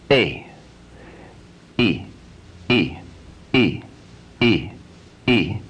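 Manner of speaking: E E E, then a forceful A; repeated E then A. Shown as a demonstration. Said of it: "continuously repeating E".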